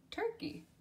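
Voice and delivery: turkey squeaked up high; very high repeat. Shown as a demonstration. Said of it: "second take of word".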